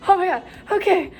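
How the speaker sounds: high, quick flustered "oh my God"; flustered student rushing off; startled excitement. Retold or imitated. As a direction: blurt it out, caught off guard and eager. Rushed to emergency, startled reaction.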